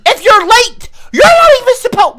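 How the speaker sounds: shouting down a late viewer, loud and high; angry yell. Real, not acted. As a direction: bark it at the latecomer. Streamer scolds late chatter.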